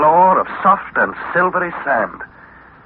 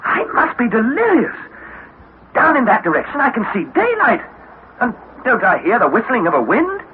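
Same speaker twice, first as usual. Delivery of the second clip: agitated questioning; 6 semitones higher than usual. Acted. Harry thinks he is delirious.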